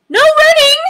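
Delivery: high loud scolding call; calling after. Acted. Librarian calling the rule after the running lion, high and loud. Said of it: "called after him".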